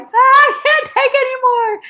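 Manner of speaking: high, overwhelmed cry; hammed-up high-pitched exasperation; fed-up meltdown. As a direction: wail it at breaking point. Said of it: "ready to just be like".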